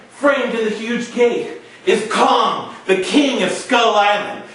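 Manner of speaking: usual speaking voice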